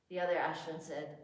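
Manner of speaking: ordinary delivery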